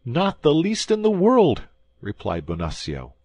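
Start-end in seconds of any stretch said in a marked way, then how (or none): 0.0-1.7 high, loud desperate begging; terrified little man pleading for mercy; pleading prisoner. A narrator's reading. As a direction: beg the commissary in a high panicky voice. Bonacieux insisting.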